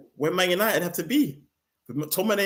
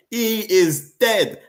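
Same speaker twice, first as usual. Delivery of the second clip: slow, higher and louder, each word hammered; exasperated outburst; 7 semitones higher, 6 dB louder, much slower than usual. Real, not acted. Fan on his own team's midfielder, slow loud verdict. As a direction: lay it out like a verdict, fed up. Ranting about McTominay.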